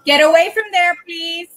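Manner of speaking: mom voice. Real, not acted. Mother calling her daughter away, unaware she is unmuted, loud mom voice. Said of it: "I love your mom voice".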